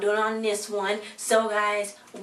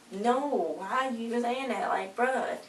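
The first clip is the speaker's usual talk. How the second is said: raised pitch, held-back volume; incredulous reaction; 4 semitones higher, 4 dB quieter than usual. Retold or imitated. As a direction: softly protest in high-voiced disbelief. Reacting in disbelief to the remark.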